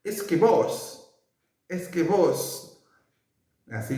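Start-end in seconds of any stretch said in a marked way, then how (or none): 0.0-2.8 imitating an Argentine, higher and slow; a light mock Argentine "Es que vos"; Argentine voseo. Teacher imitating how Argentines say vos, in a playful Argentine lilt.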